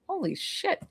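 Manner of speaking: breathless high "holy shit", very soft; hushed amazement. Retold or imitated. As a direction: gasp it out, stunned by the lightness. Sudden relief of letting go.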